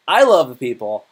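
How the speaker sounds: punchy mock-delighted I; sarcastic. Shown as a demonstration. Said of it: "That's actually how you gotta say that".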